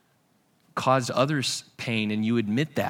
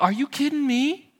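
exasperated disbelief; 10 semitones higher than usual. Retold or imitated. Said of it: "Jacob's like".

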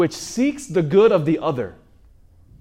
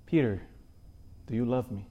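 tender question; 4 semitones lower, 10 dB quieter than usual. Acted. Jesus asks Peter tenderly.

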